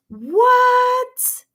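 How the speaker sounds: very high, loud, drawn-out shock; astonished exclamation. Real, not acted. Reader learns Rose is the mother's name, astonished exclamation. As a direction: let it shoot up in disbelief.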